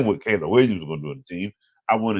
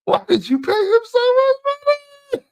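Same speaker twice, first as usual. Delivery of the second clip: high squeaky exasperated question; high-pitched mock disbelief; mock falsetto whine; 4 dB louder than usual. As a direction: squeal it at the team like a fed-up fan. Mock complaint to the Bengals.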